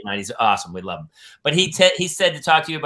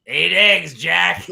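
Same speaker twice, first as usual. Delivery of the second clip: put-on gloating kid; 3 semitones higher, 9 dB louder, much slower than usual. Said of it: "some kid went home and go".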